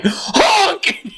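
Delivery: high yelled goose honk. Retold or imitated. Imitating a goose chef yelling in the kitchen, high honk. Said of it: "a goose yelling".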